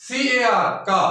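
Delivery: ordinary talk, nothing marked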